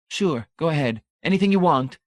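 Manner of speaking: high, proud voice of a zealot; zealous champion. Acted. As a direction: declare your faith with lofty pride. Brady's voice.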